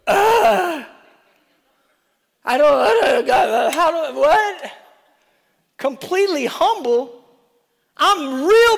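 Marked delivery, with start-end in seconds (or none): 0.1-4.7 higher, flustered mock protest; mock flustered groan. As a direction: play the squirming sinner. Preacher mocks own reaction.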